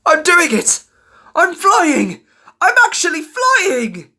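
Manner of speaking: high, loud amazed triumph; exhilarated yelling; confident elated shout. A narrator's reading. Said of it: "he shouted with confidence".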